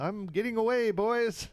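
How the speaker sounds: high playful mock taunt; taunting getaway. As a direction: tease the pursuers as you pedal off. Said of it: "ring the little bell and be like".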